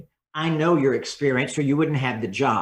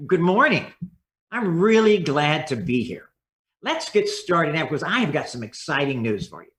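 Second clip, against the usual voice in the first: smiling, glad greeting. Shown as a demonstration. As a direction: greet them like you mean it, with a smile. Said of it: "It's gotta have a smile".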